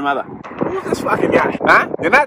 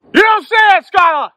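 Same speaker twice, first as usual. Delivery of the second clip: put-on high furious yell in Boston accent; shouting; 7 dB louder than usual. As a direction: scream it at him. Yelling at a friend.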